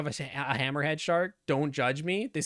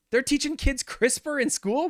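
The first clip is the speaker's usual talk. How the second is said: shocked; 9 semitones higher than usual. Real, not acted. Repeats the shocked question.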